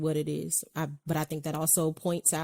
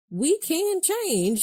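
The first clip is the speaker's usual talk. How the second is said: we can change stretched slow and high; emphatic drawn-out we can change; 10 semitones higher, much slower than usual. Real, not acted. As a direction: lean hard on we can change, slowly.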